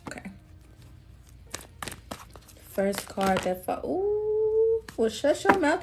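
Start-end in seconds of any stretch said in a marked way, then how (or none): 3.8-5.8 surprised. Real, not acted. Reacts to first card drawn.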